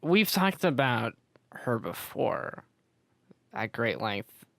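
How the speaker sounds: tipsy and slurring words. Real, not acted. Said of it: "I'm really drunk right now".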